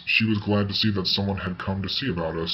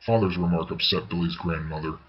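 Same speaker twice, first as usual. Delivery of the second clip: ordinary delivery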